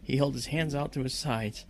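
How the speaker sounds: ordinary talk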